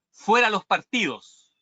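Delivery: restrained rallying cry, high and drawn out; quoted protest cry. Retold or imitated. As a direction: call out the slogan without a full shout. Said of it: "Un grito".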